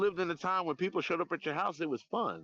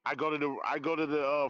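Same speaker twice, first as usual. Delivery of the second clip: ordinary talk, nothing marked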